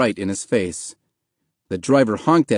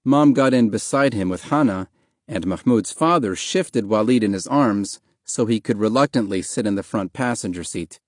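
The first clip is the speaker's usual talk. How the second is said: ordinary delivery, nothing marked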